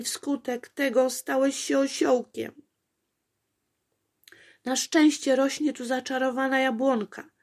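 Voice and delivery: tut-tut at the careless donkey; fussing princess girl. A narrator's reading. Apolejka scolding the enchanted donkey, fussy girl voice.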